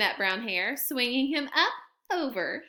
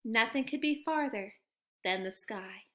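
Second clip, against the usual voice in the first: ordinary delivery, nothing marked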